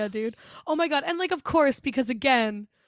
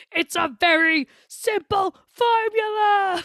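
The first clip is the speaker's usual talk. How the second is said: high, slow, loud worked-up yell; screaming; 8 semitones higher, 5 dB louder, slower than usual. Retold or imitated. Movie clerk losing it at the customers, slow high scream. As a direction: shout each word slowly, pushed past patience. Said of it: "like, screaming".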